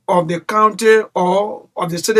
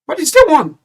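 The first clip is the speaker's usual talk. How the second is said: heated high appeal; 9 semitones higher than usual. Real, not acted. Caller pressing the official to quit.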